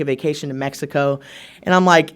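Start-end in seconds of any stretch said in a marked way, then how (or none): none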